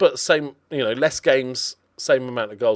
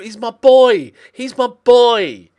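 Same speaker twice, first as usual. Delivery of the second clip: loud, high, drawn-out plea; anguished protest; 10 semitones higher, 8 dB louder, slower than usual. Real, not acted. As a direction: wail it in defence of a favourite. Torn over selling a favourite player.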